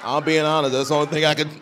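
normal talking voice

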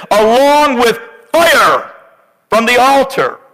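high, fervent pulpit reading; full-force preaching. Acted. Pastor reading Numbers to congregation, urgent high preaching.

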